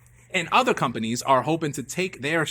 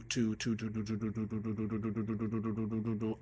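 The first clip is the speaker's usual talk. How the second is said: mock glitch stutter; 4 semitones lower, 9 dB quieter than usual. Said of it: "I'm not malfunctioning".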